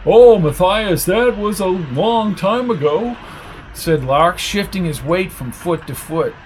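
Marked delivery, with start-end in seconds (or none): 0.0-3.2 high, slow, drawn-out protest; uneasy character voice, pitched up; squirming evader. Acted. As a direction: plead it away, stretching the words. Lark squirms under questioning.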